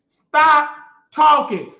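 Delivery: slow, loud, drawn-out order; stop talking. Real, not acted. Repeating the order to Rico, slow and loud. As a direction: lean on every word, loud and slow.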